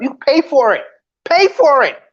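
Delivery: loud insistent rant; heated rant. Real, not acted. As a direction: hammer the advice home.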